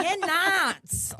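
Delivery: high, exasperated "nats" for NUTS; NUTS with an A sound. Retold or imitated. Shopper confirming to shop worker, NUTS said as "nats". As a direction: echo his "nats" back in exasperation. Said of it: "And I was like".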